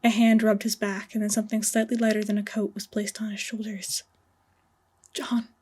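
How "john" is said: voice cracking. Acted. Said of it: "Sherlock's voice cracked".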